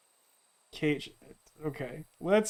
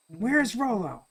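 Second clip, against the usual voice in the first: put-on high-pitched demanding young hero; Luca; 4 semitones higher, 3 dB quieter, slower than usual. Luca questioning the stranger, high-pitched demand. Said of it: "Luca's voice for some reason by pitching high".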